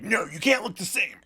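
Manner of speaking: high, petulant put-on voice; mock bossy objection. Co-host mimicking a power play, mock bossy. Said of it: "They just wanna be like".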